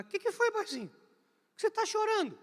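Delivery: high, tender voice of a caring boss; kind boss. Retold or imitated. Roberto Setúbal comforting the crying office boy, high and tender. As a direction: coo gently at a crying boy. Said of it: "Ele olha pra mim e fala".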